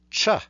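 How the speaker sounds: "cha" said as a plain unaspirated cha; Devanagari cha. Shown as a demonstration. Teacher reciting the palatal surd "cha". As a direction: say "cha" crisply with no puff of air. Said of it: "there is".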